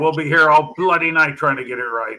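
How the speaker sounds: loud mock parade-ground scolding; hammed-up exasperated sergeant major; British sergeant major. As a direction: berate the lot like a drill sergeant. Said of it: "I could just hear the Sergeant Major".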